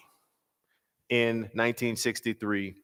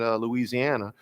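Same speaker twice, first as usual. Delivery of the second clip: normal talking voice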